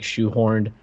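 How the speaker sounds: normal talking voice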